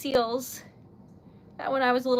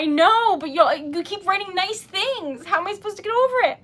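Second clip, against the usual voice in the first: tearful; 4 semitones higher, 6 dB louder than usual. Real, not acted. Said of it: "I need to stop cry".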